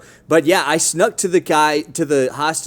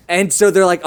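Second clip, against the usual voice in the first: ordinary talk, nothing marked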